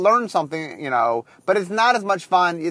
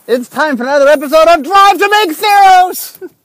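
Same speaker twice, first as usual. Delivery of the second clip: announcer voice; 10 semitones higher, 10 dB louder than usual. Acted. Podcast intro, announcer flourish.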